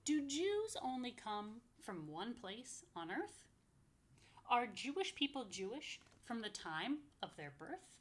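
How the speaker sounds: sing-song rhyming read-aloud, slow on the lists; lively picture-book reading; reading to children. Acted. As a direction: bounce the rhymes for little listeners. Reads book text to kids.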